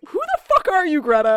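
loud, high-pitched mock disbelief; incredulous outburst. Podcast host reacting to a character's eagerness, incredulous. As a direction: blurt it out high and loud, appalled at her.

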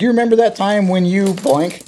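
lightly voiced husband asking; mimicking husband's question. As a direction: quiz her like he did.

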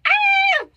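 short, high, startled "ah" shriek; mock panicked yelp. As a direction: squeal like you've just been pushed on stage.

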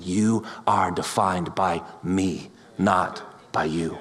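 slow, low, tender words; a slow, hushed Jesus; Jesus gently. Acted. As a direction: speak it softly as Jesus restoring him. Jesus speaks to Peter.

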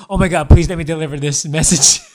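loud, laughing mock plea; hammed-up eager request; gleeful begging. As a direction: beg for it with barely held laughter. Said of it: "he says".

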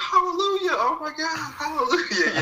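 very high, ecstatic hallelujah in mom's voice; joyful mom. Retold or imitated. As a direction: squeal it like an overjoyed churchgoer.

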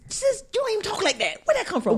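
high, incredulous quoted question; playfully scandalized disbelief; baffled. Retold or imitated. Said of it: "I'm like".

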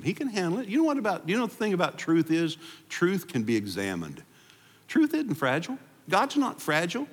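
high-pitched, rising fervor from the pulpit; fervent preaching. Acted. Pastor fires up his congregation, fervent high preaching. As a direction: lift the congregation, preaching at full force.

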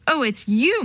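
ordinary delivery, nothing marked

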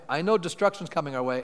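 ordinary delivery

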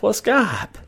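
high, baffled question; mock baffled. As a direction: play dumb about Skype. Mock ignorance of old tech.

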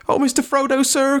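very high, frightened servant cry; high-pitched fearful plea; quaking. Acted. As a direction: appeal to the master in a high, panicky voice. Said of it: "cried Sam, quaking".

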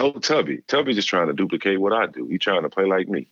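a hint of the coach brushing off a rival; imitating the coach. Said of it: "He said".

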